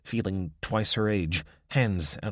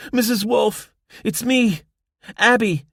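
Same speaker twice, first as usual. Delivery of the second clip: much higher, loud call across the woods; 9 dB louder than usual. A narrator's reading. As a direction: shout to be recognised. Abby calls to Irma in dark.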